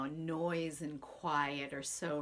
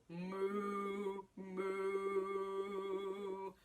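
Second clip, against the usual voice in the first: cow moo; 2 semitones higher than usual. Acted. Cow noises in story.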